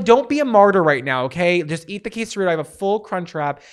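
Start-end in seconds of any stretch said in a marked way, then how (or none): 0.0-2.5 annoyed insistence. Retold or imitated. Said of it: "'Cause I was like".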